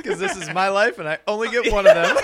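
laughing mock plea. Customer insisting on favorite barista, mock earnest through laughter. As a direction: build it up till the laugh takes over. Acted selfish speech, laughing.

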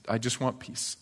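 hushed repeat, almost to yourself; soft weary echo. Acted. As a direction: let it fade like a tired longing. Echoed, fading to near whisper.

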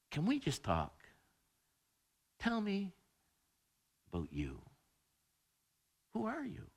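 hushed gentle asking; soft request. Retold or imitated. Child asking a parent who they are, hushed. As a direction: ask softly, almost whispering. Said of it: "the child says to a parent".

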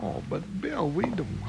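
breathy whisper so they won't be heard; hushed whisper. Acted. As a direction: keep it low and secret, almost voiceless. Sneaking, hushed in hotel.

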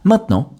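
"maintenant" as main-t'nant, T kept; silent E. Shown as a demonstration. Teacher gives learners a word to repeat, "maintenant" with silent E. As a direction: model "maintenant" in two syllables without the middle E. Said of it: "Le E de maintenant a complètement disparu".

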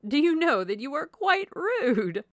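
high, loud, slow chiding; airy wife teasing her husband; playfully scolding Mrs. Palmer. A narrator's reading. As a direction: scold him gushingly, stretching the words.

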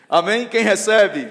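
loud, high shout to the congregation; rousing call. Real, not acted. Preacher calls for congregation's response.

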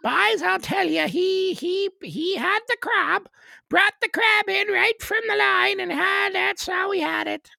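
high, shouted rural Newfoundland yarn; Newfoundland accent. Acted. Newfoundlander tells the boys a crab story, loud high Newfoundland accent. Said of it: "a little more Ottawa Valley with Newfoundland".

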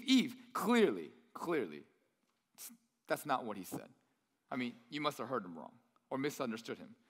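low, soft sly persuasion; lightly voiced serpent. Serpent persuading Eve she misheard, low quiet coaxing.

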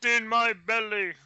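loud, high Scottish monster voice; Scottish accent. Pretend. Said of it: "meant to be your Scottish accent".

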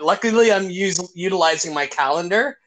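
ordinary talk, nothing marked

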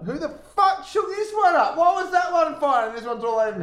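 high startled yelp; shocked outburst. Real, not acted. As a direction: caught off guard by spraying beer. Beer sprayed over him.

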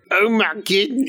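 high, hammed-up Scottish 'goodness'; mimicked Scottish accent. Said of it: "that's what I was mimicking".

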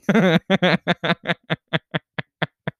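long staccato cackle, trailing off; laughing. Real, not acted. Storyteller cracks up at own Applebee's joke, long laugh. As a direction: laugh hard in quick bursts.

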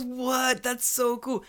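high, delighted "What? That's so cool."; excited cop. Retold or imitated. Policeman thrilled to hear they're from the UK, excited. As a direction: gush like an excited fan. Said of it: "He goes".

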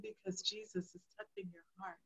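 very soft, hushed fatherly tenderness; barely voiced, reverent reassurance; sweetest voice. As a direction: imitate a dad gently murmuring. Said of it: "the sweetest voice I've ever heard".

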